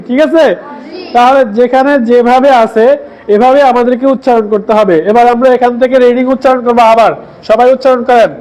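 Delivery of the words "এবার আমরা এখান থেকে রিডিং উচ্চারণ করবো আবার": ordinary delivery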